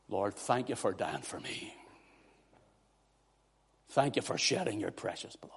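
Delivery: soft prayer, fading towards a whisper; hushed prayer. Real, not acted. Preacher thanking the Lord for dying, hushed prayer. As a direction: drop to a hushed, reverent thanks. Prays to the Lord mid-sermon.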